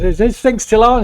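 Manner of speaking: tipsy host tapping the mic; mic check. Acted. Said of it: "He says".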